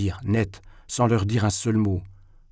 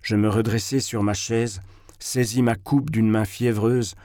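plain reading voice

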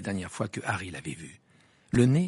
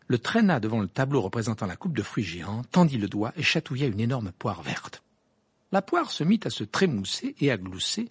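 plain reading voice

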